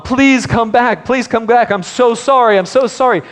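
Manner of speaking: put-on high, loud, begging voice of a small boy; child pleading. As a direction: relive the child begging at the door. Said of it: "I was pleading to my mom".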